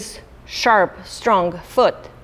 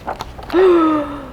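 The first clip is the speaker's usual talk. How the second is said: high startled gasp; sharp gasp; 7 semitones higher than usual. Acted. Storyteller to children, a high startled gasp. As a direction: gasp as the fruit tips. Fruit about to crash.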